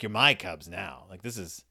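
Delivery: voz mais grave e satisfeita, como o Ben orgulhoso; low, self-satisfied murmur, lightly played Ben. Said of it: "Ben's just like".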